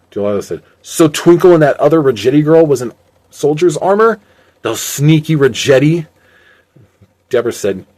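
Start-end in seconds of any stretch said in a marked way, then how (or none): 0.8-6.1 higher, louder disbelief; indignant surprise. A narrator's reading. Delilah learns of the Rajetti disguise, indignant. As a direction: react loudly to being tricked.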